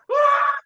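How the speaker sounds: high sudden yelp; a startled, hammed-up cry; mock yell. Retelling a fight, a sudden mock yell. Acting out an outburst.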